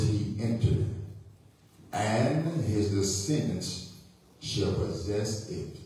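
low, very slow, measured delivery; solemn scripture reading. Real, not acted. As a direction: read the verse with long pauses. Rereads the scripture text.

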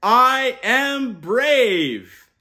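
rousing call to repeat. Shown as a demonstration. Uncle leading kids to repeat, loud and slow. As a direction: stretch it out big so kids join in. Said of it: "Repeat after me".

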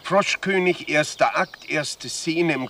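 stage announcement. Acted. Director announcing the first scene of the play. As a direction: call out act and scene to the cast. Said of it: "director announces the scene".

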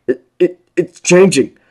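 loud, high, stammering cry; panicked call. A narrator's reading. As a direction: call back in rising panic. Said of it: "called back".

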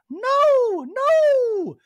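very high, loud squeal of protest; Dorit impression. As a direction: squeak it in a shrill put-on Dorit voice. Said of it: "Dorit's like".

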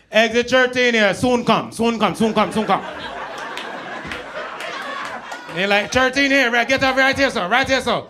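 lightly done Jamaican patois, relaxed; easy Jamaican "soon come"; Jamaican accent. Retold or imitated. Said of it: "you won't want no Jamaican G P S".

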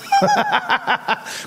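high, long real laughter. Real, not acted. Cracks up at his own story. (צחוק אמיתי גבוה)

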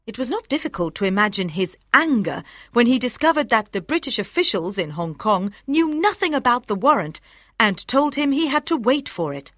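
ordinary delivery, nothing marked

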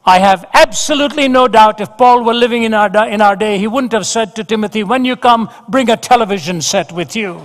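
loud, high preaching with rising fervour; full-force preaching. Acted. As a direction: preach it out to the hall with full fire.

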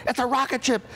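high-pitched furious rant; imitated dad screaming. Dad screams at son over the car, mock scream. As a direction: yell it high, accusing your kid. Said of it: "He's now screaming at me".

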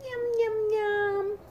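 high, happy yum yum; playful yum. Acted. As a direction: relish the food in a high voice. Mock eating relish.

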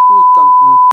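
strangled high cry from the spice burn; cursing through a burning mouth; mouth burning from spicy food. Real, not acted. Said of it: "Napapamura ko sa ahang".

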